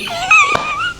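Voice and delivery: shrill burst of laughter, way up high; high squealing laugh. Real, not acted. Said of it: "Sorry, I didn't mean to laugh".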